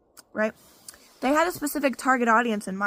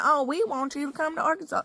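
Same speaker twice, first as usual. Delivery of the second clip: higher, hammed-up Arkansas twang being mocked; mocking Leslie's Arkansas accent, pitched up; 4 semitones higher than usual. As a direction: parody the Arkansas accent the way she mocks it. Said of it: "making fun of Arkansas accent".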